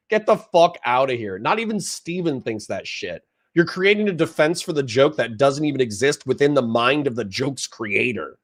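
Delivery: ordinary delivery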